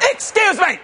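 imitated high, pointed challenge; confrontational tone. Angry stranger stopping a preacher, confrontational. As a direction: play the stranger spoiling for trouble. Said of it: "tone something going wrong".

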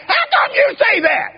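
high, loud amazed question; preacher shouting his amazement; astonished shout. Retold or imitated. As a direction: shout it in disbelief. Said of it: "And he shouted".

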